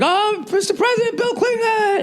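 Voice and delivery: high excited shouting to the president; high starstruck cheering; fans calling out. Retold or imitated. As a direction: squeal it like a starstruck crowd. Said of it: "everybody's like".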